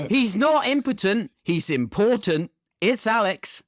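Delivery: slow, high hype introduction; hammed-up MC introduction; podcast announcer. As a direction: stretch the rhyme, lift the name up high. Announces cast member.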